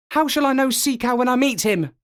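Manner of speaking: put-on high, quick young seal question. A narrator's reading. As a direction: ask eagerly like a pup. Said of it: "said Kotick".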